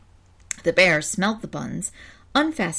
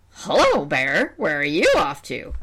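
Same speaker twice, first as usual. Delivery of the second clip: vulture voice; 2 semitones lower, slower than usual. Acted. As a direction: play a sly vulture. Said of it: "that's the vulture voice".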